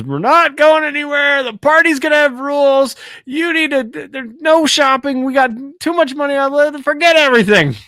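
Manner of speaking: high, hurried Carl voice laying down rules; high-pitched panicky backpedal; flustered Carl. Retold or imitated. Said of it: "Carl immediately shuts down and says".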